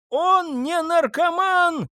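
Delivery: high, slow, loud scream. A narrator's reading. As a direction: scream in defence of her son. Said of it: "заорала Хелен". (истошный крик)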